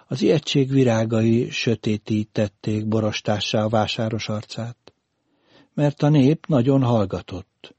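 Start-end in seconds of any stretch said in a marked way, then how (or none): none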